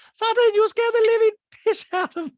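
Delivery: piercingly high, loud caricature; exaggerated mock quote. Host restarts housewife's scolding, very high loud mock voice. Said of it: "She's like".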